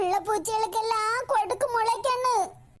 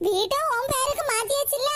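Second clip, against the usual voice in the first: ordinary talk, nothing marked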